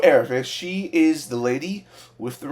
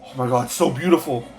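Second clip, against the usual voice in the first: ordinary delivery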